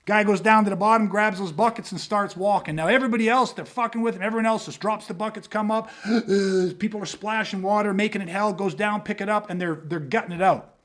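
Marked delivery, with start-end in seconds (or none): 6.1-6.7 mimicked gasp for air; imitated gasp. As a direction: gasp like surfacing from under water. Swimmers come up for air.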